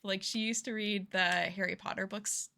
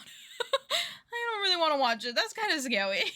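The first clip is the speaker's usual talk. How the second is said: scared whiny self; 7 semitones higher than usual. Retold or imitated. Imitating her scared self at home, high whiny laughing. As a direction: whimper it like a scaredy-cat. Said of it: "I'll just be like".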